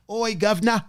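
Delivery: badly faked British accent, giggly; terrible British accent. Host shows off to the chat, terrible British accent. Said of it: "It's actually terrible".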